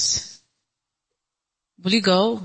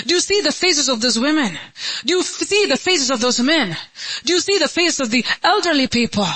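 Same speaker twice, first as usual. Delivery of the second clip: high-pitched, insistent appeal; impassioned preaching; 7 semitones higher, faster than usual. Real, not acted. Preacher making the congregation picture suffering people, insistent appeal. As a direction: hammer each question out, pitch raised.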